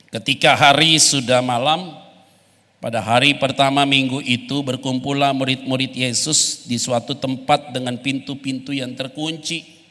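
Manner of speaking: loud, measured Bible reading; scripture reading. Acted. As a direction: read the passage aloud to the church.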